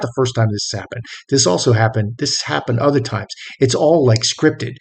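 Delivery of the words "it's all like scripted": ordinary delivery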